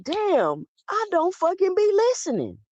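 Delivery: lightly dramatized dawning realization; retold realization. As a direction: act out suddenly catching your own fault.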